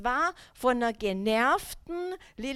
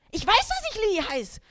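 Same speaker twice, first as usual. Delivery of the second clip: higher, louder, fed up; exasperated outburst; 9 semitones higher, 4 dB louder than usual. Retold or imitated. Worn-out mother losing patience with her son, higher and louder. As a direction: snap it out, at the end of your rope.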